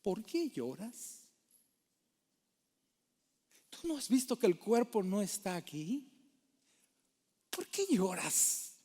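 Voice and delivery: a gentle, hushed prod to make her think; soft probing question. Acted. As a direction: angels quietly urging María to reflect. Voicing angels prodding María to reflect.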